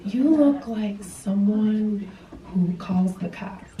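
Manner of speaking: soft, slow coaxing sweet talk; soft, slow, mock sweet reassurance; mock tender husband. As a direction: break it to her gently like a husband. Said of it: "And he's like".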